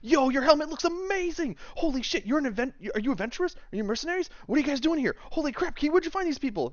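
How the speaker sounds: high, fast, breathless fanboy gushing. Acted. Swain rushing over to Zakis, gushing in a high excited voice. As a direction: gush over every detail like a starstruck fan. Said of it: "runs over and goes".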